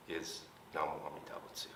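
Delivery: softly whispered Namu Amida Butsu; whispered reverence. Acted. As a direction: breathe it out as a hushed secret. Reverent climax of the teaching.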